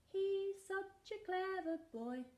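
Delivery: high, slow puppet voice praising; a slow high puppet cheering the dog on; squeaky helper puppet praising. Acted.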